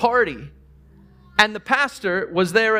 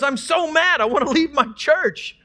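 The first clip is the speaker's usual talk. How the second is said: retold angry caller; 7 semitones higher than usual. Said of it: "He goes".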